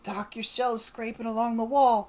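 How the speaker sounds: high, loud warning; urgent warning. Acted. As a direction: call it out to a noisy companion. Warning noisy Doc across the party.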